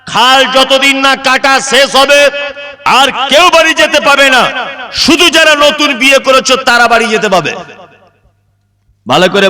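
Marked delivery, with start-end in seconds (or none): 0.1-7.5 high-pitched proclamation to a crowd; announced order. Acted. Preacher voicing an order to the canal diggers, high and proclaiming. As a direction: lay down the rule in a raised, ringing voice.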